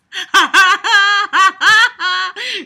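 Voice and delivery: a real burst of high laughter, half crying; high cackling laugh. Real, not acted. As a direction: crack up mid-sentence, up high. Bursts out laughing mid-quote.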